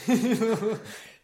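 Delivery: a burst of loud, high laughter. Real, not acted. Co-host laughing at the host's disappointed "Oh". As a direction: crack up loudly.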